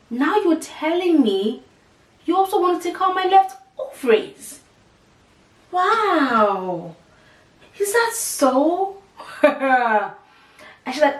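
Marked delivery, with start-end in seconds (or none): 0.1-9.0 high, slow, disbelieving sarcasm; incredulous. Retold or imitated. Mocking the surgeon's news with high slow disbelief. 9.2-10.1 short laugh at the absurdity; rueful laugh. Real, not acted. Laughing at her own retold outrage.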